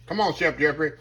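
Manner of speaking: ordinary delivery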